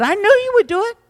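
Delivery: high-pitched protest as retold complaint; indignant complaint. Preacher acts out Jonah complaining to God, indignant.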